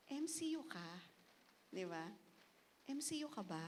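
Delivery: soft, tentative asking; unsure question. Retold or imitated. John unsure if he meets a classmate, soft tentative question. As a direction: ask quietly, not sure. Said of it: "Sabi niya".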